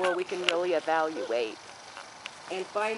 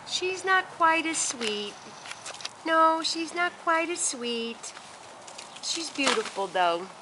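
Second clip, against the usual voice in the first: slow, higher teasing tone about the dog; 8 semitones higher than usual. Real, not acted. Owner teasing her less sweet dog, slow high voice. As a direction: tease her lightly, drawn out and high.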